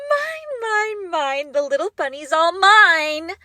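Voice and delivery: manic glee. Acted. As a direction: sing it out like a prize she has won. Manic possessive outburst.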